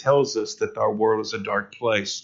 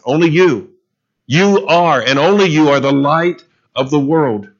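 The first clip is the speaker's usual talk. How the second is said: "you" and "only" punched high and loud; emphatic you; 3 semitones higher, 5 dB louder than usual. Shown as a demonstration. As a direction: drive home "only you" to the congregation. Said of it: "it is emphatic".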